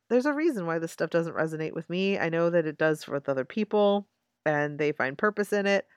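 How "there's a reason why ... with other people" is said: normal talking voice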